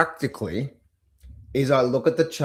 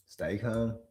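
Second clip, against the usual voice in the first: calming; 3 semitones lower, 9 dB quieter than usual. Pretend. Calming himself after mock panic.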